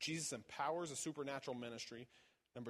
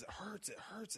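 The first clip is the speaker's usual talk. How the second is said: whispered, higher, like someone hurting; pained whisper; 8 semitones higher, 4 dB quieter than usual. Retold or imitated. Retelling the woman's pain during prayer, pained whisper. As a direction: breathe it out in pain, mock patient. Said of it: "she says".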